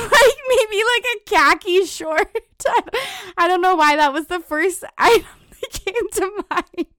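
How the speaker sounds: laughing. Real, not acted. Laughing at her own outfit.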